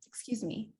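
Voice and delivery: soft, gentle "excuse me"; polite tone. Shown as a demonstration. Coach showing how to interrupt nicely, soft and polite. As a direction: say it softly and politely, without attitude. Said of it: "that's very different than".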